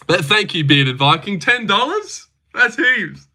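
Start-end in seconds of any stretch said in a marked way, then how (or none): 1.4-3.3 high, put-on excited impression; Nubby. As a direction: pitch it up in mock amazement. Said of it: "for a Nubby impression".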